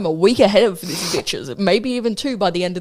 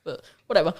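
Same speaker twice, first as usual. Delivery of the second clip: ordinary delivery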